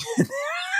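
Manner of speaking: sudden high shrieking laugh; real high-pitched laugh burst; high squealing laugh. Real, not acted. Laughs at own family impression.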